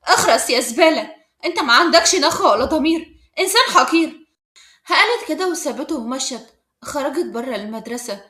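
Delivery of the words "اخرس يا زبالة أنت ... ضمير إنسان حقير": loud, cutting insults; scathing and forceful, louder; sharp. A narrator's reading. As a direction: tell him off harshly, voice raised. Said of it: "إسراء بحدة".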